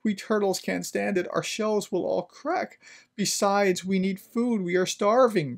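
high-pitched, pleading little turtle voice; small suffering underling, voiced high; groaning plea. A narrator's reading. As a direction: plead upward to the king from under a heavy load. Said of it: "groaned Mac".

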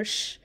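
sh as a soft bare hush; isolated quiet sh; sh sound demo. Shown as a demonstration. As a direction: demonstrate sh without a vowel. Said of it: "como sh".

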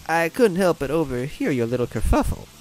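put-on high-pitched old lady voice; old woman. A narrator's reading. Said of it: "the old Koopa woman said".

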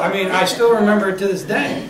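usual speaking voice

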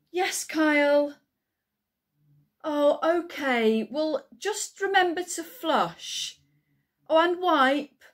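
high, loud, harried instructions; parental calling out. Acted. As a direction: call through to a child in another room. Calls toilet instructions to Kyle.

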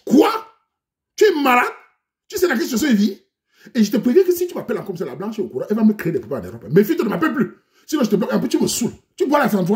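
high, loud furious scolding; angry rebuke. Acted. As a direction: snap at her to never call again. Man angrily rebuffs her on phone.

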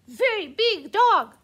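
shouting the name-calling back. Acted. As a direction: raise the stakes in the squabble. Trading insults back and forth.

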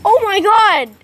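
loud, high shocked cry; startled exclamation. Real, not acted. Owner startled after accidentally throwing the toy, loud high outburst. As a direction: blurt it out in surprise.

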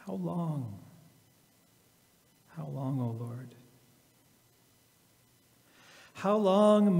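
slow, reflective reading with long pauses; slow scripture reading. Acted. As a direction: let each line sink in like a prayer.

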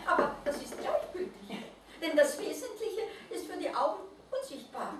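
high, laughing, overjoyed little creature; joyful. A narrator's reading. Mole rat repeats the Christkind's words, laughing with joy. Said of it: "außer sich vor Freude".

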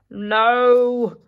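whispered bear growl; a hushed growling no. Acted. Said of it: "growled Gary".

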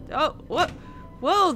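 ordinary delivery

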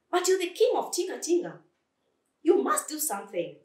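high, quick urging; urgent pleading. Acted. Flea urges timid Lion to act.

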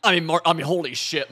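excited outburst. Real, not acted. Gamer shocked his team bailed him out, excited. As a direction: blurt it out in disbelief. Reacting to lucky play.

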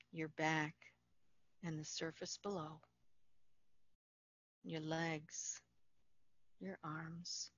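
calm, unhurried meditation voice with long pauses; guided meditation. Acted. Facilitator guiding listeners through Yoga Nidra, calm guided meditation.

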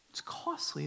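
hushed repeat of costly; whispered echo. Real, not acted. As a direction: whisper the word costly back.

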